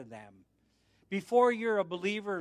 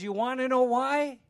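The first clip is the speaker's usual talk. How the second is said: high, loud question to a crowd; retold teasing question thrown to the room; calling out; 7 semitones higher, 5 dB louder than usual. Acting question to congregation.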